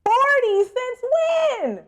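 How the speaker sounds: very high, long-held shriek of disbelief; astonished yell. Real, not acted. Player seeing forty approval, astonished yell. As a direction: draw it out way up high.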